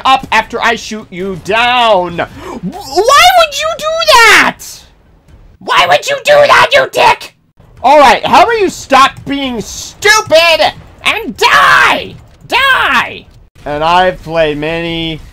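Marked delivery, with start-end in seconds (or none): 2.2-13.3 high furious yelling at enemies; raging gamer. Real, not acted. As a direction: shout at the enemies in rage.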